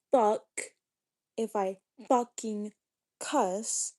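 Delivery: broken-up, punched-out angry scolding; emphatic anger. Real, not acted. Streamer telling off a viewer over cussing, emphatic and angry. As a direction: punch out each chunk with pauses between.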